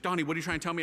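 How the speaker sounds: normal talking voice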